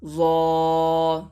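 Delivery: زو said thick and full from the back of the mouth; زو with a full, rounded heavy sound. Shown as a demonstration. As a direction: make زو heavy yet gentle. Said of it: "موٹا کر کے پڑھنا ہے، نرمی سے پڑھنا ہے".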